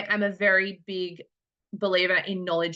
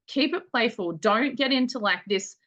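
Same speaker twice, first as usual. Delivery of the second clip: normal talking voice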